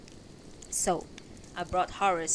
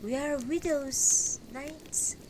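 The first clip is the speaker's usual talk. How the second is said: slow, higher, knowing voice; strangely smiling widow; 7 semitones higher, slower than usual. Acted. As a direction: reveal it slowly with a strange smile. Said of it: "smiles strangely and says".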